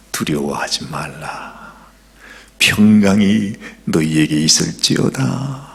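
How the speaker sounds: hushed and tender; gentle comfort. Acted. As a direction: speak peace over frightened men. Jesus comforts the frightened disciples.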